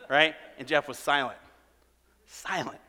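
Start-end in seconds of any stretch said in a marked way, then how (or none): none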